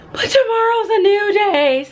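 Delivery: high, drawn-out lilt; sing-song optimism. Real, not acted. Teacher shaking off a rough day, sing-song optimism. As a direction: lift it high and stretch it out hopefully.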